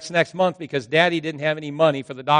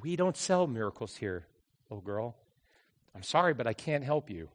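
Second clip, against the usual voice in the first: softer, gentler apology; softening; 2 semitones lower, 9 dB quieter than usual. Retold or imitated. Pharmacist turns gentle toward the little girl. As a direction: let him soften toward the child. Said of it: "softening a little bit".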